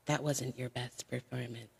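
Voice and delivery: low, slow, soft deadpan criticism; critical messager. Retold or imitated. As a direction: deliver the put-down quietly and slowly. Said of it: "send me messages after and be like".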